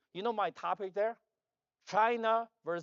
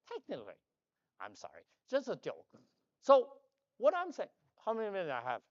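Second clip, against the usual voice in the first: normal talking voice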